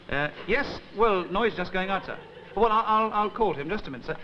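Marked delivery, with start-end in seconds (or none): none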